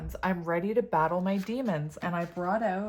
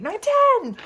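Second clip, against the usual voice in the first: much higher, loud excited cry; excited shout; 14 dB louder than usual. Real, not acted. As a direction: shout out the last number in delight. Count lands on ten again.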